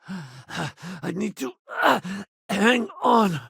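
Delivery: winded, halting and slow; breaking off to recover; out of breath. Acted. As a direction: sigh and struggle to get it out. Winded, has to stop.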